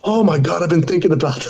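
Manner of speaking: a hint of dawning realisation; friend's relief. Drunk friend realizing his mistake, mock amazed. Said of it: "And he's like".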